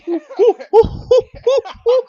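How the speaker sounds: high, loud hooting like a monkey, breaking into laughter; mock monkey calls, laughing through them; monkey hoots. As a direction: hoot like an excited monkey. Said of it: "acting like a fucking monkey".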